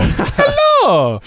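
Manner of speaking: high, loud video game plumber; Mario voice. Pretend. Comedian greets podcast host as Mario, high and loud. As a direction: bounce it out like Mario. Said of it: "Classic games".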